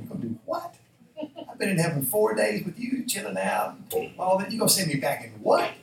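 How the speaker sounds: high, loud comic disbelief; hammed-up outraged objection; incredulous protest. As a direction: protest loudly, voice leaping up in disbelief. Lazarus protests being sent back.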